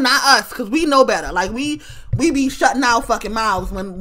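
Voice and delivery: ordinary talk, nothing marked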